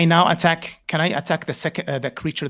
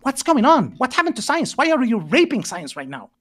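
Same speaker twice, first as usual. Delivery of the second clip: raised, high-pitched outrage; seething anger; 7 semitones higher than usual. Real, not acted. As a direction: lose your temper at him. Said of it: "I'm sorry about my temper".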